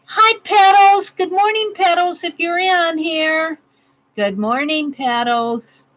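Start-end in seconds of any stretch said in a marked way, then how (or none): 0.0-3.6 excited greeting. Real, not acted. Greeting a viewer by name.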